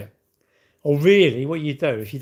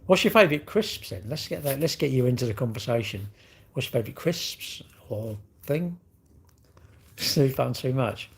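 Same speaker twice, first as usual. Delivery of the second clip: ordinary talk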